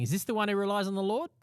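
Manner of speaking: high-pitched sneering question; incredulous jeer, pitched well up. Acted. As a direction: quote the scoffers with a mocking lift. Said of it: "They sneer and shake their heads".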